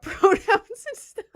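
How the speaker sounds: amused, laughing through the words. Real, not acted. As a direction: crack up mid-sentence. Laughs while mocking the topic.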